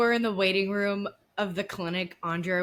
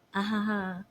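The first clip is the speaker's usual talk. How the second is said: unbothered. Retold or imitated. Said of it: "Kelly's just like".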